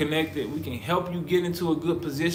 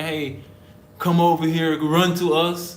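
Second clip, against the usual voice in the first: louder, higher mock summons; mock calling out; 3 semitones higher, 7 dB louder than usual. Not going out recruiting people, mock calling out. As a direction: call out as if beckoning people over.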